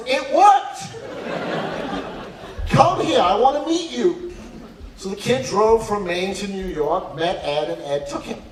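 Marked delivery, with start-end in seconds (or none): 0.1-4.3 delighted exclamation. Retold or imitated. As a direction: exclaim it like a won-over dean. Dean delighted by kid's trick.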